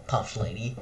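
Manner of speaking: plain reading voice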